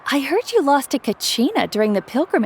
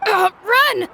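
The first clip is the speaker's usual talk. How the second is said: panicked shout; 8 semitones higher than usual. Acted. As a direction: yell it as you bolt away in fright. Kids flee the scolding neighbor.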